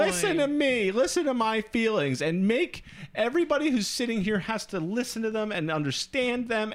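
put-on high, pleading little-kid whine; whiny child. Mocking an emotional terrorist demanding attention, high childish whine. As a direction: beg to be heard like a toddler. Crying out for mommy.

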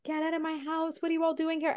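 imitating a father losing it, pitch jumping up but volume held back; retold scream. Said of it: "and he just screams".

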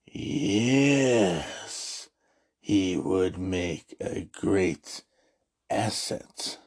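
low, slow, sinister Emperor imitation; a hint of the scheming Emperor, low and drawn out; lightly voiced Emperor. Emperor plotting with Vader.

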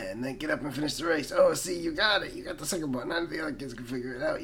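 normal talking voice

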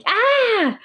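a high, short yelp of pain, imitated; mock cry of pain. Boyfriend yelping in pain as her leg brushes him, mock yelp. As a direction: squeal like he got pricked. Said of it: "ele deu um grito de dor, assim".

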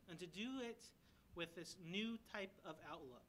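rally the church to minister; earnest sermon close; preaching exhortation. Acted.

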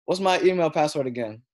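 breezy mom voice on the phone; imitating mom. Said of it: "she'll call me off the random and be like".

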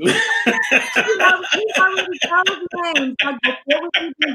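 talking through laughter; giggling as she speaks; laughing. Real, not acted. Laughing about baby names.

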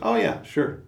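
high, airy, easygoing yes; breezy Otter. Retold or imitated. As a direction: toss it off like a carefree otter. Carefree Otter agrees.

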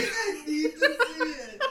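loud, slow, laughing. Real, not acted. Laughing at the synopsis.

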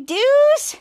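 calling out high and loud; shouted name. Acted. Villain's sidekick yelling for Deuce, loud call. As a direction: shout the name across the room.

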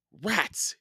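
high-pitched little grumble; mild exasperation. A narrator's reading. Jack finding his glasses muddy, mildly exasperated. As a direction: curse lightly in a high voice.

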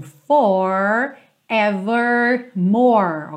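"forevermore" with every R curled American style; standard American accent. Shown as a demonstration. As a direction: curl all three Rs in "forevermore". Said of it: "todos esses R's são enrolados".

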